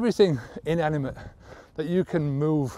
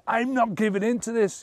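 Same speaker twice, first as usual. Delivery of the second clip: determined; 8 semitones higher than usual. Shown as a demonstration. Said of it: "you can add other stuff".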